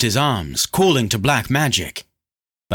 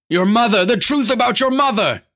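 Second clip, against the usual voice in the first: high, loud desperate screaming; 13 semitones higher, 4 dB louder than usual. A narrator's reading. Lanlo screams out before Lorn lets go, desperate scream. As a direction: cry out in a sustained high scream. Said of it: "he screamed out".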